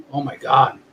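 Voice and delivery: usual speaking voice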